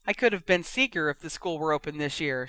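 ordinary delivery

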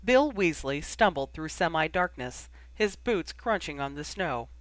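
ordinary delivery, nothing marked

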